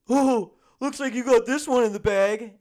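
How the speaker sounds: mock-cheery roommate voice; mocking jinx voice. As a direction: play the clueless roommate as a dopey cheerleader.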